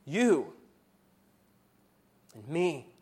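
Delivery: usual speaking voice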